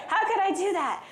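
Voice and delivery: high, exasperated scolding of oneself; mock exasperation, pitch jumping up; worked-up self-reproach. Driver scolding herself over a missed exit, exasperated. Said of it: "you get yourself all worked up".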